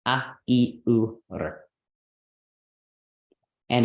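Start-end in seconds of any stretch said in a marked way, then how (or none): 0.1-1.6 "A, I, U, R" said as separate short vowels; "A, I, U, R" demonstrated one by one; vowel demo. Shown as a demonstration. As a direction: list "A, I, U, R" as crisp single sounds. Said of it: "ends with a short, simple vowel".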